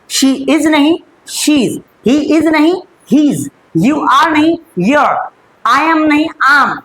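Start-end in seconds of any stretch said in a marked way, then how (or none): none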